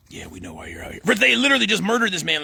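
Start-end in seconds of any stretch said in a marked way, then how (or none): 0.1-1.0 much quieter and lower; subdued retold reply. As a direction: answer low, as the cowed deputies. Deputies' subdued reply in retold confrontation.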